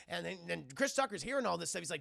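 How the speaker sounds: normal talking voice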